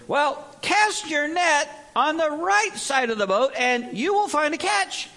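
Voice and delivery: high, loud, slow call across the water; calling back. Acted. Jesus calls instructions from the beach, slow loud call. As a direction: call out slowly so it carries to the boat. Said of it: "he called back to them".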